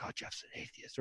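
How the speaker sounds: imitating a coworker's whispered gossip; breathy secret whisper, voice almost gone; gossipy whisper. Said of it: "whispering in the, in the corners".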